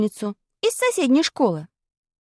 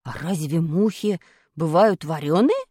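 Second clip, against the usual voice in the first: put-on puzzled boy; 8 semitones lower than usual. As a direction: ask in mild surprise. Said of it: "удивился Зябликов".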